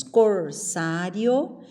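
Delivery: "corsário" slowly as cor-SÁ-rio; joined diphthong. Shown as a demonstration. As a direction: lift the joined rio at the end. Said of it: "Se nós falamos".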